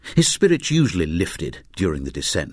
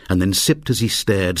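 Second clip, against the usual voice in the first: usual speaking voice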